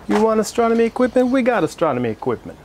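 a cringey put-on New York accent. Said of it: "that New York accent".

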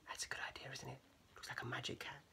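very soft, whispery comment; hushed aside. Real, not acted. Reader to child looking at the picture, hushed aside. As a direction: drop to a near whisper. Quiet aside to young listener.

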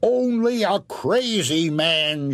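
put-on high, slow mean old woman shout. Wife shouting back at the ghost, high scornful voice. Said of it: "she shouted".

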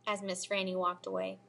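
usual speaking voice

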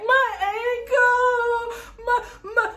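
high, slow crying wail. Retold or imitated. To her family, wailing high and slow over her ankle. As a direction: wail the words out in pain.

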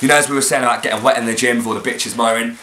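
ordinary talk

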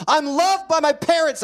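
put-on high, forceful kid's cry; emphatic declaration. Boy declares who he is while hammering, emphatic cry. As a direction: proclaim it with a burst of energy.

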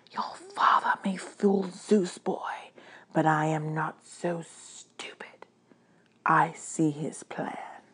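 hushed, whispery menace; quiet, slow threat from an underworld lord; menacing god of the dead. Acted.

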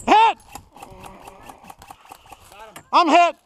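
loud high shout; man down shout. Real, not acted. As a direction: yell it across the field. Player hit, calling for medic.

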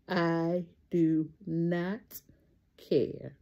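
stretched I do not, a low falling care; drawn out word by word; slow emphatic catchphrase. Real, not acted. Vlogger telling sunshines what to say, slow.

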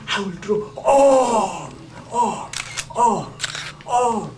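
slow, loud, ringing all, voice crying; tearful preacher's all; emphatic all. Real, not acted. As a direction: cry out all, all, all. Preaching Jesus's words, crying.

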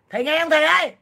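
loud, high call to the teacher. Real, not acted. Streamer calling out to her teacher in the chat, loud. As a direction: raise the voice to get his attention.

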